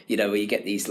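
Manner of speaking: usual speaking voice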